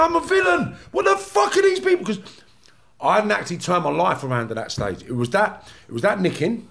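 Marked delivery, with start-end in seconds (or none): 0.0-2.0 incredulous inner shout. Retold or imitated. Old villain shouting at the snitches around him in disbelief. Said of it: "I'm thinking".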